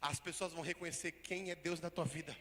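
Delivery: loud, slow, sung-out preaching; preaching at full force. Real, not acted. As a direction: proclaim blessing over the church.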